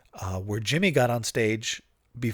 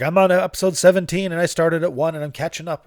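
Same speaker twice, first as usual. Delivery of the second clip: loud, high eager report; excited fan; 7 semitones higher, 7 dB louder than usual. Retold or imitated. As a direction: gush like a proud fan catching up. Fan's excited report, quoted.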